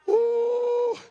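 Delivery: one long, high preacher's whoop; sustained, high-pitched exclamation; high held "ooh". Real, not acted. Preacher's exclamation mid-sermon.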